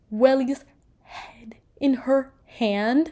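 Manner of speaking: slow whisper on the gory reveal; hushed reveal. Real, not acted. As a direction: breathe it out, stunned. Shocking reveal in the story.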